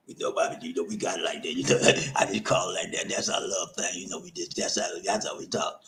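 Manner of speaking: low, soft, offhand voice with a laugh; mock defensive partner. Partner shrugging off the B word, low and soft. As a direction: brush it off lightly, like it's just our thing. Voicing a couple defending name-calling.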